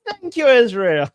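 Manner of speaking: joyful grandma. Retold or imitated. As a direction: thank them like a giddy grandma. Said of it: "She's like".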